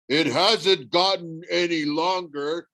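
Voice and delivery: slow, grumbling giant; a hint of a suspicious monster; lightly voiced cyclops. A narrator's reading.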